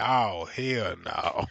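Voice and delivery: low, slow, drawn-out "oh hell no"; disgusted refusal. Retold or imitated. To the horses fucking at the light, a slow low refusal. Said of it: "I said".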